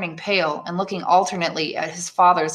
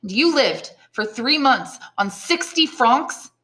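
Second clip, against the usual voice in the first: higher, slow, incredulous question; shocked exclamation; 6 semitones higher, slower than usual. Acted. Son learns how father lived, slow disbelief. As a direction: spell out the shock phrase by phrase. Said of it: "exclaimed Edmund".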